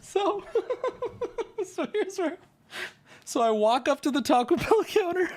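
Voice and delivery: high-pitched talk through laughter; cracking up. Real, not acted. Storyteller walking up to the counter in his tale, cracking up. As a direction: struggle to continue while laughing.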